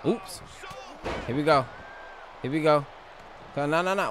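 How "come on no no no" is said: ordinary talk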